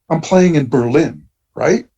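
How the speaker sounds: ordinary talk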